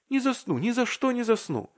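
very high, insistent denial; acted high-pitched insistence; excited protest. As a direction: protest eagerly at the top of the voice's range. Vasya insists he won't sleep.

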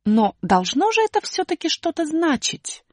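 выше, задумчиво; З размышляет вслух. Acted. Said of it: "задумчиво произнесла буква". (задумчивый высокий голос)

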